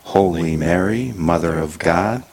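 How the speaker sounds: usual speaking voice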